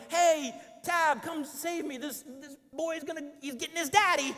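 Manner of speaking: high, cutesy mock plea to the wife; lightly sung-out cute call; mock-cute cry for rescue; cute call for help. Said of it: "it wasn't like a cute".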